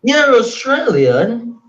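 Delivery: high, drawn-out mock reaction; retold UK listeners. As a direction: imitate them, stretching it out high. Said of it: "They were like".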